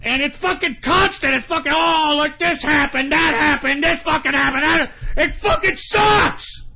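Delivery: high ranting that builds to a shout; frustrated rant. Real, not acted. Broadcaster sick of constant problems, rant building to yell. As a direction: pile up complaints, then explode. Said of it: "it's frustrating".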